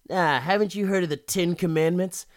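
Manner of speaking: sanctimonious sneer; preachy villain. Retold or imitated. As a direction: scold him while holding him up. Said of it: "he's like".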